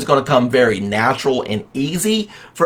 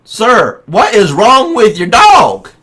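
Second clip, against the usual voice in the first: louder, higher, slower mock salesman; exasperated salesman; 6 semitones higher, 4 dB louder, slower than usual. Salesman finally asks the farmer what is wrong with the dog, mock exasperation. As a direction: lean in hard on the salesman's question, a touch exasperated.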